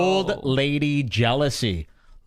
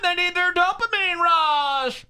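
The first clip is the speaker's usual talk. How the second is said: mocking high voice; 12 semitones higher than usual. Mimicking woman, high voice.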